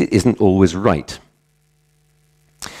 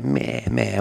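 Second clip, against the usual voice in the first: a quieter mock goat meh; soft goat bleat; 3 semitones higher, 8 dB quieter than usual. Teacher bleating again for the class, a soft mock goat meh. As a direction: bleat it again, softer and more hopeful.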